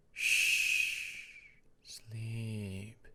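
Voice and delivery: soft, faint hushing whisper. Acted. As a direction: hush him gently to sleep. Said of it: "It was faint".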